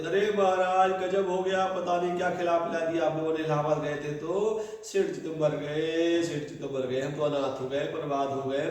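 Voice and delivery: loud, high mock lament over a dead husband; fake wailing. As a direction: wail like a hammed-up widow. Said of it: "सेठानी महाराज रोते". (सेठानी का नकली विलाप)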